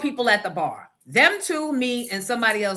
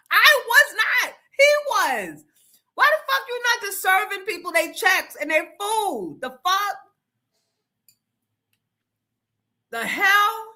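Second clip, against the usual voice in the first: high excited indignation; 12 semitones higher than usual. Real, not acted. Host denying to chat, high and excited. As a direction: protest while still half laughing. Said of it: "still high from laughing".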